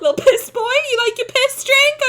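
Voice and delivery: put-on high, loud baby-talk taunt; mocking taunt. Streamer taunting beer lovers, high mocking baby talk. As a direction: tease him like a child.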